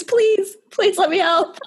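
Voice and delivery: high, thin, laughing plea; frail pleading voice. Retold or imitated. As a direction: beg weakly like a feeble old servant. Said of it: "this frail voice".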